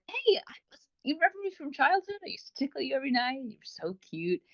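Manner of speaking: high playful voice that hides something creepy; cute tickle monster voice. Retold or imitated. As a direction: coo it like a sweet childhood monster. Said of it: "the cute and creepy vibe ... starts off like".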